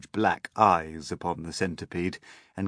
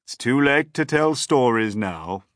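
put-on old grasshopper, higher and louder; firm announcement; 6 semitones higher, 8 dB louder than usual. A narrator's reading. Old grasshopper ends the storytelling, firm announcement. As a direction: announce bedtime to everyone. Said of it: "the old green grasshopper announced".

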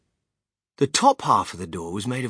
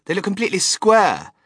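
put-on spoiled girl; 7 semitones higher, 7 dB louder than usual.

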